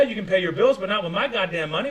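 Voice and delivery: ordinary talk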